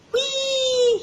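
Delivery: very high, loud delighted squeal; shouted whee. Acted. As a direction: squeal with glee up the tree. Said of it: "he shouted".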